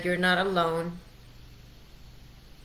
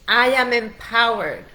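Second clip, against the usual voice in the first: louder, higher declaration to be repeated; loud affirmation; 3 semitones higher, 8 dB louder than usual. Shown as a demonstration. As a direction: proclaim it so they shout it back. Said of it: "Repeat that out loud with me".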